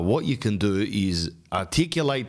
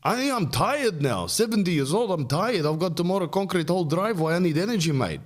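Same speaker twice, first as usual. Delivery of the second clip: high-pitched put-on old man; hammed-up weary old father, pitched high; seventy-year-old dad; 11 semitones higher than usual. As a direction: plead tiredness in a cartoon dad voice. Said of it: "And he goes".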